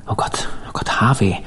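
hushed, horrified near-whisper; groaning. A narrator's reading. Dan to Harvey on seeing the corpse, groaning. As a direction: groan it softly under your breath in horror. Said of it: "ächzte Dan".

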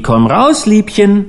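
teasing call, rising high at the end; lightly acted jeering hail; mock call. A narrator's reading. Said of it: "mit Schreien und Rufen empfangen".

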